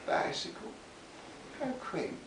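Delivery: mock-posh, high and hushed disdain; derisive sneer. A narrator's reading. Lightly voiced posh gentleman scoffing at a boy's bicycle. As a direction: drip scorn over the word. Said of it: "pregnant with derision".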